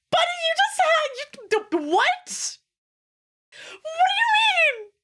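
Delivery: very high, loud disbelieving shout; shocked outrage. Retold or imitated. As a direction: yell at him like he's lost his mind. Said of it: "And I'm like".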